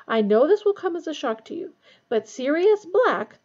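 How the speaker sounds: serious, slowing down on the name. A narrator's reading. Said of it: "in a very serious voice".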